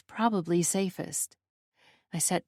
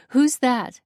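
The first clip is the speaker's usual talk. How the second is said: sharp raised demand; 6 semitones higher, 8 dB louder than usual. A narrator's reading. Narrator startled by a scream, demanding. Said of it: "I demand".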